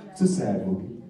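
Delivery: low, soft sad-movie voice. Shown as a demonstration. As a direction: drop into sorrow for the sad film. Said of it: "It's now a sad movie".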